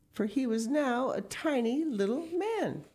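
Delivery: usual speaking voice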